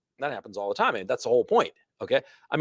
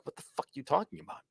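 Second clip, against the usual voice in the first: dismissive brush-off; 10 dB quieter, faster than usual. Pretend. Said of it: "I'd be like".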